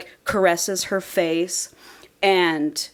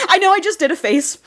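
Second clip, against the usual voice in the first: real laughing; 9 semitones higher than usual. Real, not acted. Host owning up to her face, laughing as she talks. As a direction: crack up while owning up. Cracking up over awkward hand job.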